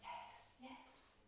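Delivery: soft, breathless whisper; whispered yes. Acted. Humbled queen to the goddess, a soft whisper. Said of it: "said Queen Metanira".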